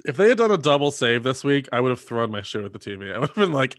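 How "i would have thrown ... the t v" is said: normal talking voice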